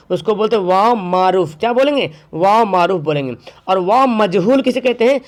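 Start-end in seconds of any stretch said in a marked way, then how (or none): none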